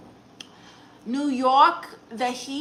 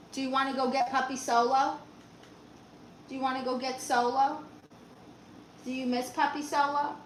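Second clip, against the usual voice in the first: soft pet-owner talk, lilting up at the end; coaxing a dog; 2 semitones higher, 5 dB quieter than usual. Pretend. Owner asks her dog about the puppy, coaxing. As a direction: coax the dog gently with each question.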